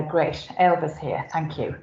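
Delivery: ordinary talk, nothing marked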